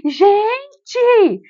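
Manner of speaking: high, loud call for attention; mother in labour shouting at the chatting nurses; exasperated shout. Retold or imitated. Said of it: "eu falava".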